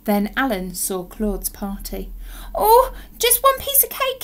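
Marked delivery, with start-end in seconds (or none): 2.6-4.2 high, whiny begging; pleading cry. A narrator's reading. Alan seeing Claude's party, pleading cry. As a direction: cry out for one last treat, high and loud. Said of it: "he cried".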